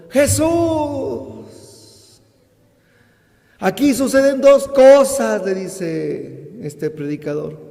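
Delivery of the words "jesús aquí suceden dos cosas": high, loud cry to Jesus; raised voice. Retold or imitated. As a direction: call out to Jesus, voice lifted. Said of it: "levantó la voz y le dijo".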